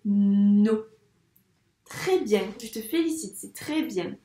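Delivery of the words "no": no said loudly with a held n; isolated syllable. Shown as a demonstration. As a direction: lean on the n of no. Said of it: "dans la syllabe". (no accentué : « nno »)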